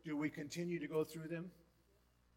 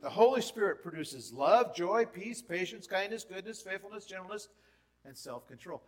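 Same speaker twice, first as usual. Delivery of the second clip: higher and louder, rattling off the fruits; excited rapid list; 4 semitones higher, 8 dB louder than usual. Shown as a demonstration. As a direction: sweep through the list with rising enthusiasm. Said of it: "He produces this fruit".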